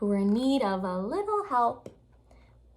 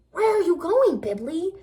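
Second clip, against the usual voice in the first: higher, louder surprised question; 7 semitones higher, 7 dB louder than usual. Acted. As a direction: call out to him in surprise.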